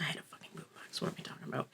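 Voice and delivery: a muttered self-correction; whispered aside. Real, not acted. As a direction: whisper it to yourself, embarrassed.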